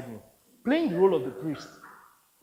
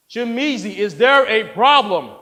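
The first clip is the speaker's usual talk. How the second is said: loud, higher confrontation; stern demand; 5 semitones higher, 10 dB louder than usual. Acted. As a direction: press the question hard and loud. Director confronts objecting reader.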